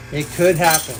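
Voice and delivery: ominous low voice. Shown as a demonstration. Streamer teasing chat with a Pig Latin stream, ominous low. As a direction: make it sound like a dark threat.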